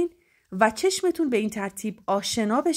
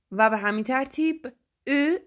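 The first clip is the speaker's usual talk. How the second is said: normal talking voice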